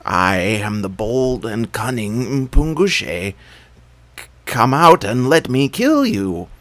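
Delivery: slow, hollow boast with a stumble; nervous bravado. A narrator's reading. Jackal boasting at the hidden thief, nervous bravado. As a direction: puff up but shake inside. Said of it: "called out nervously".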